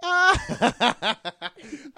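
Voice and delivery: high, loud burst of laughter. Real, not acted. Laughing at friend.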